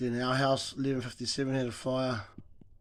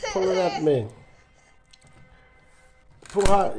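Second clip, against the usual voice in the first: loud, higher shout of encouragement; calling out; 5 semitones higher, 6 dB louder than usual. Real, not acted. Father urging son pulling a nail, loud cheer. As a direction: cheer him on to pull.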